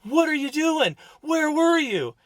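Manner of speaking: high, loud scolding shout; retold yelling. Father jumping out of the truck at his lost son, yelling. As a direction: yell it like a panicked parent demanding answers. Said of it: "I start yelling at him".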